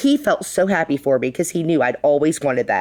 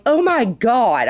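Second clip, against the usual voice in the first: slow, drawn-out, higher "oh my god"; stunned; 7 semitones higher, much slower than usual. Retold or imitated. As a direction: stretch it out in disbelief. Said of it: "And I'm like".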